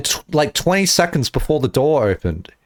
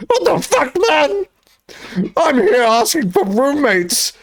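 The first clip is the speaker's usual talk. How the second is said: high-pitched hammed-up rant; mock tirade; 9 semitones higher than usual. Streamer berating a job-seeking uni friend, hammed-up tirade. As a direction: wail it in exaggerated outrage.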